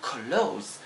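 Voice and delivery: clothes as one syllable ending in z, klohz; clothes said correctly. Shown as a demonstration. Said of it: "No, no, no".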